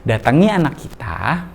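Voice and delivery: ordinary talk, nothing marked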